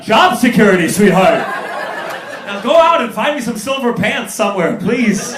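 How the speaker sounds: loud, high comic snapping at a partner; hammed-up defensive outburst; acted exasperated retort. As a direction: shout the order in mock desperation. Acting exasperated reply to girlfriend.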